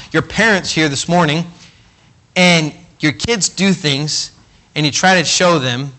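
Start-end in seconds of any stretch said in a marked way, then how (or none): none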